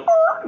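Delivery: high strained growling snarl; imitated dog growl. Kevin squeezing the dog, the dog snarls.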